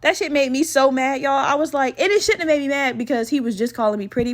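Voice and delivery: usual speaking voice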